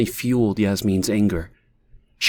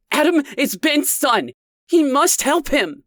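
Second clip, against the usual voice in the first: bellowing; 3 dB louder than usual. A narrator's reading. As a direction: bellow it at her. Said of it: "Leah bellowed once again".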